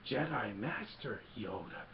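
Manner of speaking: slow imitated creature, pitch swooping in wonder; Yoda. Yoda realising whom Luke seeks, slow swooping impression. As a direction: draw out each word like a wise puppet. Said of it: "And then Yoda's ears go up".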